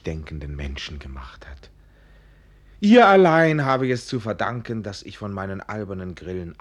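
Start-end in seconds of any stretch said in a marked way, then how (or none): 0.0-1.7 geflüstert, tief; Flüstern. Acted. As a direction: leise zu sich gehaucht. (Anselmus flüstert reumütig zu sich)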